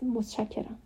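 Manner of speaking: hushed, understated word of thanks; quiet voice. A narrator's reading. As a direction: say it quietly, barely projecting. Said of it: "آهسته گفت".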